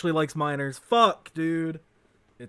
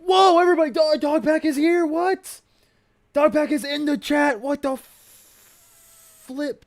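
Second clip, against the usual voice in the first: high, hammed-up excited announcer; high, mocking excited gush; mock hype; 13 semitones higher, 8 dB louder than usual. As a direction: overdo the streamer excitement. Mock hype over Dog Pack.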